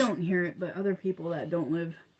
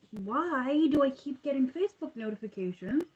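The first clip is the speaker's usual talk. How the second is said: higher, whiny exasperated question; 6 semitones higher than usual. Real, not acted. Crocheter interrupted by Facebook notifications, exasperated. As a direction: complain at the phone, pitch lifted.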